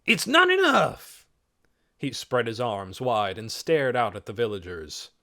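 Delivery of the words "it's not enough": high, loud, drawn-out protest; shout. A narrator's reading. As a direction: shout it slowly in anger. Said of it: "shouted Trog".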